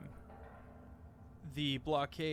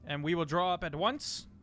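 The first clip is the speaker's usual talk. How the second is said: loud formal reply; 5 semitones higher, 5 dB louder than usual. Acted. Ambassador answering Lord Somber, loud and formal. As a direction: declare it firmly to the council.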